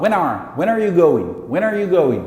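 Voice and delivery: "When are" joined as "whe-nare"; linked words. Shown as a demonstration. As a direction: run "When are you going" together. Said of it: "Então ele vai falar".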